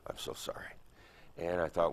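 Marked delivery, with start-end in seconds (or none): 0.1-0.7 breathy whisper of an apology to mom. Retold or imitated. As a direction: whisper it as if to himself. Repeated inner plea, unvoiced.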